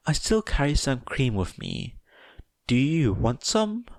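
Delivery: put-on kindly steer the girl; motherly advice. Mother gently advising Toga to ask Izuku. Inko offers cream.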